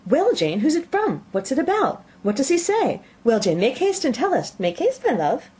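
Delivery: high, breathless, impatient questions; eager calling out. A narrator's reading. Mother pressing her daughter for the letter, eager and high. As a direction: rush her, dying to know. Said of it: "eagerly calling out".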